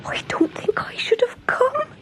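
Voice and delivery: very high, breathless frightened whisper; Country Mouse tiptoeing into the kitchen. Acted. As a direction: whisper in terror. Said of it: "she whispered".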